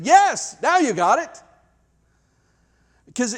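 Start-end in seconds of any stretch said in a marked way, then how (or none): 0.0-1.4 delighted president. Retold or imitated. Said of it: "the president of the university said".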